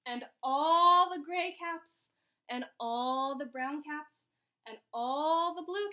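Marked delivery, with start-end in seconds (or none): 0.1-2.1 "all" stretched long and loud; stress on ALL. A narrator's reading. Narrator as every cap comes down, ALL drawn out. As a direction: swell hugely on ALL, slowly. Narrator builds up the falling caps.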